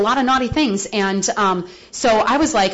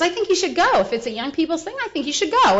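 sponsor's encouragement; 7 semitones higher than usual. Retold or imitated. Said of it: "and she says".

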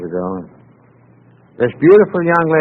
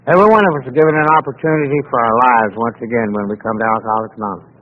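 ordinary delivery

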